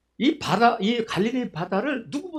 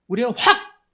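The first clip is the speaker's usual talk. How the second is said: sudden burst; 4 semitones higher, 5 dB louder than usual. Shown as a demonstration. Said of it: "제가 확 하는 것보다".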